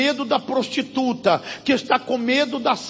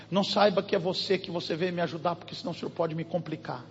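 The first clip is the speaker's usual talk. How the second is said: hushed anxious plea; 6 semitones lower, 9 dB quieter, much faster than usual. Retold or imitated. Frightened woman explaining her fear.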